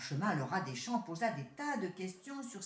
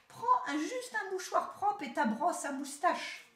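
higher, louder, slow impatient order; 10 semitones higher, 4 dB louder than usual. Acted. City rat impatiently telling his cousin what to pack. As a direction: hurry him along, fed up. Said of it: "d'un ton impatient".

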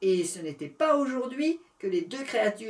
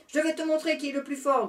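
growling whale; 7 semitones higher, 5 dB louder, faster than usual. Acted. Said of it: "grogna la baleine".

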